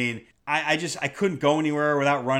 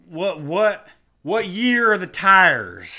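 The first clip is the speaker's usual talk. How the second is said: louder, drawn-out mock question; exasperated imitation of a pestering buyer; 7 dB louder, slower than usual. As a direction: mimic a clueless buyer, stretching the words.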